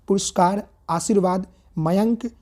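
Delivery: Mayank drawn out slowly; slow reading of option. Shown as a demonstration. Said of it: "reading options".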